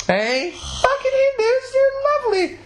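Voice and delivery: high silly cry of joking praise; squealing aside. Real, not acted. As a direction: shriek it in over-the-top glee. Joking aside while reading sign.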